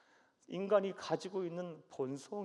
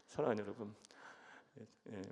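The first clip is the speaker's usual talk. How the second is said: normal talking voice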